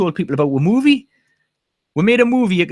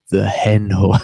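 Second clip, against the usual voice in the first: sultry voice; 9 semitones lower than usual. Pretend. Jokingly repeats in sexy voice.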